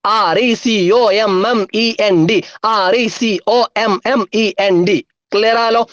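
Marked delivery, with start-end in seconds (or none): 0.1-2.4 letters joined in one run; quicker, even spelling chant; speedy spelling. Shown as a demonstration. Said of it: "സ്പീഡിൽ പറഞ്ഞേ". 2.6-5.0 letters joined in one run; quicker, even spelling chant; speedy spelling. Shown as a demonstration. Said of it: "സ്പീഡിൽ പറഞ്ഞേ".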